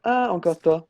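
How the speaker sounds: fed up to see him back; exasperated surprise. Pretend. Guard greets returning traveller. (agacé de le revoir, plus fort et plus aigu)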